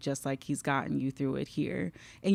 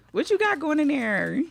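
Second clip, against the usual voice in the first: playful; 11 semitones higher, 6 dB louder than usual. Real, not acted. Teasing about hidden gifts.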